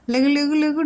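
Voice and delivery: high, sing-song wake-up call; waking call. Real, not acted. Owner rousing her sleepy dog, high sing-song call. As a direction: coax the dog awake.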